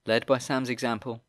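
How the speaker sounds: ordinary delivery, nothing marked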